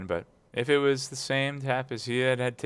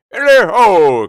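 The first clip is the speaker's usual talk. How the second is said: much louder, higher whoop; jovial cry; 11 semitones higher, 18 dB louder, slower than usual. A narrator's reading. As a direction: call out with glee. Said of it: "cried old Fezziwig".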